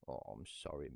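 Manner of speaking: ordinary talk, nothing marked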